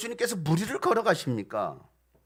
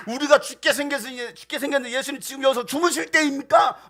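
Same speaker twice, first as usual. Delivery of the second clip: higher, louder indignant complaint; angry protest; 7 semitones higher, 5 dB louder than usual. Acted. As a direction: confront the sleeper in anger. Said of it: "예수님께 화를 내면서". (화를 내며 따지듯)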